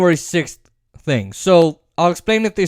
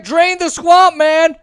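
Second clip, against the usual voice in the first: mock quote; 9 semitones higher, 4 dB louder, slower than usual. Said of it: "She's like".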